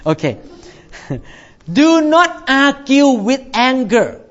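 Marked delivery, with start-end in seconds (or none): 1.7-4.2 slow earnest. Real, not acted. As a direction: Do not argue slowly, word by word. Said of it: "sermon advice".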